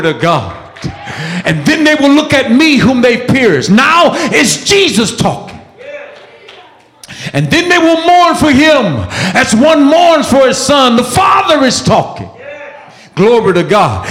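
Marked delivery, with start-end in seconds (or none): 1.4-12.3 high, urgent preacher's cry; full-force preaching. Acted. Preacher urging congregation to seek rescue, full-force preaching.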